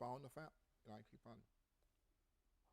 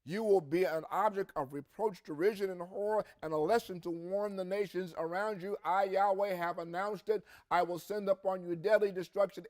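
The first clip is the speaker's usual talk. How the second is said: raised, driving scripture reading; 4 semitones higher, 4 dB louder than usual. Acted. Preacher reading Ezekiel aloud to congregation, proclaiming with force.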